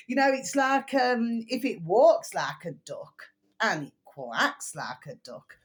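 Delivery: usual speaking voice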